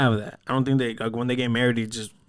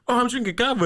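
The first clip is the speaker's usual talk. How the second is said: mock cousin voice; 11 semitones higher than usual. Imitating cousin on phone.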